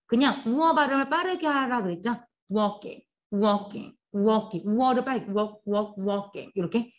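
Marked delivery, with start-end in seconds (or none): 2.5-6.5 walking을 L 없이 "워킹"으로, 우어를 빠르게; walk의 L은 소리 내지 않고 "우억"처럼; silent L 발음. Shown as a demonstration. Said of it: "워크에서는 silent L". (L 없이 발음하는 walking 시범)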